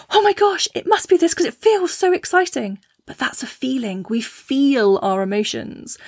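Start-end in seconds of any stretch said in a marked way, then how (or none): 0.1-2.8 much higher, gushing mock thrill; imitating breathless over-the-top excitement; jazz hands excitement. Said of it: "what I call jazz hands".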